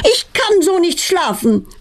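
acted angry outburst; yelling. A narrator's reading. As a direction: cry out high as an exasperated mother. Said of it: "brüllt Petronellas Mutter laut".

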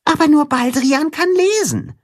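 hohe piepsige Maulwurfstimme; Maulwurf. A narrator's reading. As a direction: erinner ihn mit kleiner hoher Stimme. Maulwurf erinnert. (Maulwurf erinnert Timme, hoch und piepsig)

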